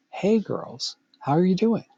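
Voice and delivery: friendly, rising polite hello to a group; polite greeting. Pretend. Someone greeting a group of girls politely, bright and courteous. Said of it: "asking politely".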